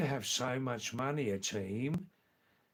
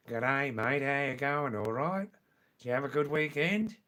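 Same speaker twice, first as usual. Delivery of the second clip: broad, louder Australian greeting; original Australian accent; 3 dB louder than usual. Shown as a demonstration. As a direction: run it together in broad Australian. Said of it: "In original Australian".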